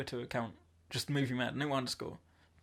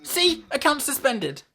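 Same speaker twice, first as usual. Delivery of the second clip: shocked outburst; 8 semitones higher, 9 dB louder than usual. Real, not acted. Shocked at suspension.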